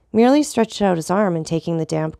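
usual speaking voice

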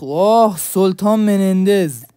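loud, drawn-out delighted announcement; excited exclamation. Real, not acted. Gamer greeting the Menendez skin, loud excited exclamation. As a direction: belt out the name slowly, thrilled.

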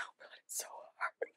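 laughing under breath, voice gone to a whisper; a strained little laugh-whisper; whispered laugh of despair. Real, not acted. Laughing at decluttering struggle.

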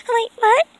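high character voice. Acted. Character hears a noise.

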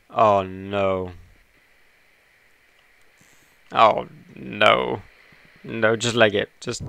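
loud panicked cries. Real, not acted. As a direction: panic while running away. Being chased.

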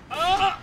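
loud, high real shriek; startled scream. Real, not acted. Gamer struck by the armored enemy, shrieking. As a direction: yelp as the hit lands. Attacked in game.

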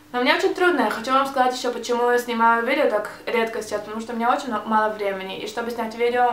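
ordinary delivery

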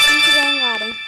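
loud. Real, not acted. Gleeful punchline about colourful course.

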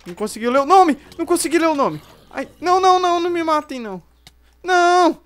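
loud, high panicked pleading; begging in panic. Real, not acted. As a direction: plead desperately for your life. Zombies killing him.

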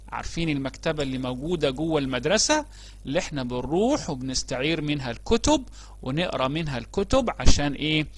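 ordinary talk

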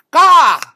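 loud, very high bird cry; penguin caw. A narrator's reading. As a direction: let out a sharp penguin call. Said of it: "said the penguin".

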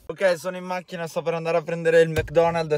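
normal talking voice

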